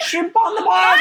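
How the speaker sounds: high, loud hammed-up Australian; mock Australian accent. Mimicking clubbers who copy her friend, mock Australian accent. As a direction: imitate a bad Australian accent through laughter. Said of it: "tries to repeat my accent back".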